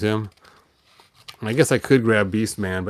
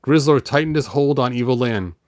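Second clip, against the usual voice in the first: ordinary delivery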